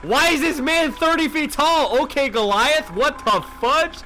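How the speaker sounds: high, loud, slow astonished cry; shocked disbelief. Real, not acted. Streamer shocked by a huge customer, loud disbelief. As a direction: gape at the giant.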